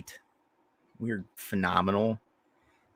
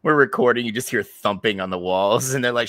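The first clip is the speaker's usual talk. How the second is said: normal talking voice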